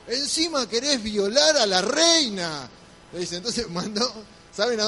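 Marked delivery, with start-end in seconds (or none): 0.1-2.7 slow, loud, higher outraged accusation; king catching Haman at the queen's feet, furious. A narrator's reading. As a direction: thunder it like an enraged king.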